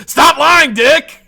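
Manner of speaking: a high, loud yell at the liar; furious shout. Pretend. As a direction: blast it at him, pitch way up. Imagined confronting lying senator.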